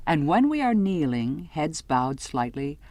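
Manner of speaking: plain reading voice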